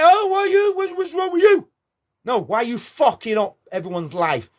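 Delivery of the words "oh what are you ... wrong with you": high, scornful imitation of a complainer; mocking whiny reply. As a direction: mimic them squealing back at you. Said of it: "you'll be like".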